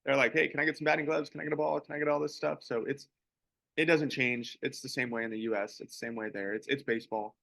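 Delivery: ordinary talk, nothing marked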